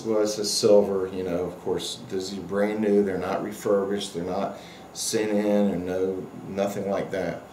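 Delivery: ordinary talk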